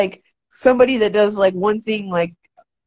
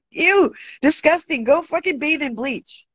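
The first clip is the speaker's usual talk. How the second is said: squealing laugh into high, grossed-out teasing; disgusted; 8 semitones higher than usual. Real, not acted. Streamer teasing a viewer about dirty hands, squealing in disgust. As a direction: recoil at the chat in playful disgust. Reacting to chat's poo fingers.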